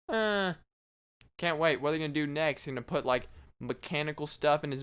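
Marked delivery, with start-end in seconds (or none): 0.1-0.5 higher-pitched mummy drone; mummy groan imitation. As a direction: toneless mechanical "uh". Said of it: "he makes a, uh, sound".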